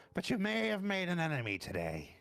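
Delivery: warning officer. Acted. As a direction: warn the hobgoblin in a high officer voice. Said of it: "looks over at the hobgoblin and goes".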